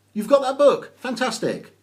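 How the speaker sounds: light up at the news; delighted squeal of surprise. Real, not acted. Viewer shows her book.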